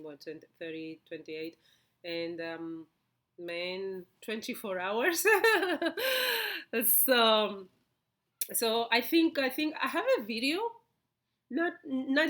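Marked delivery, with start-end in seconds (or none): 5.3-6.8 high, loud burst of laughter; real laugh, high and loud; amused laugh. Real, not acted. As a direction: laugh out loud at your own remark. Amused by her cycle comparison.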